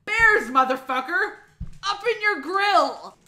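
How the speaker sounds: usual speaking voice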